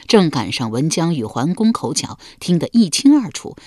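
ordinary delivery, nothing marked

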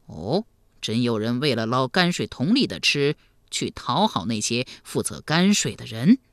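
low, incredulous elder's voice; deep, measured disbelief; questioning emperor. Acted. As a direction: ask gravely, as a troubled ruler.

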